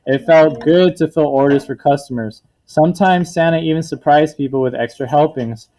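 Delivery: lively picture-book read-aloud to a class; teacher reading a story to her class; reading to children. A narrator's reading. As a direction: tell it to the kids with bright storybook rises.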